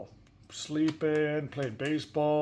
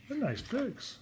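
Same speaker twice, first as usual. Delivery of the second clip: ordinary delivery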